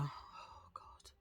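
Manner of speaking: soft, unvoiced groan of dismay; whispered oh God. Real, not acted. Dreading the memory of the walk, whispered. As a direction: breathe it out in disbelief.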